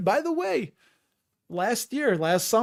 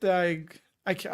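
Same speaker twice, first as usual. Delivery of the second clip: ordinary delivery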